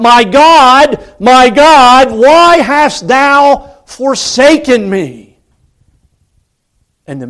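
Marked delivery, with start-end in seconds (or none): 0.0-5.4 much higher and louder, slow, stretched out; anguished cry. Retold or imitated. Dying man crying to God from the cross, anguished. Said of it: "he would cry out".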